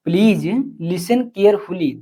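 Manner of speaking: please listen carefully, each word drawn out slowly; slow, careful model sentence; slow dictation. Shown as a demonstration.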